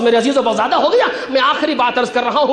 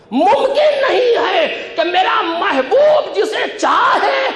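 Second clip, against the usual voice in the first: raised, emphatic pulpit voice with stretched key words; impassioned preaching; 6 semitones higher than usual. Acted. Preacher explaining the rule of love to the congregation, impassioned. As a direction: proclaim the rule with full conviction.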